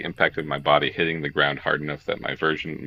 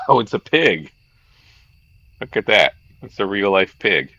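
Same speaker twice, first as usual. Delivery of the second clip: amused excitement; 5 semitones higher, 7 dB louder than usual. Real, not acted. Streamer delighted by pig character.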